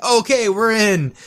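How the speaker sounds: hammed-up gung-ho enthusiasm; mock eager agreement. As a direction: imitate guys signing on without a second thought. Mimics team instantly agreeing on sight.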